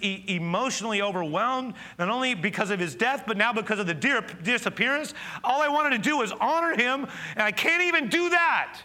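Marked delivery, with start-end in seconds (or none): 5.4-8.8 high, loud anguished cry of a grieving woman; Mary's despair. Acted. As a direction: cry it out as a woman who lost everything. Pastor voices Mary's despair.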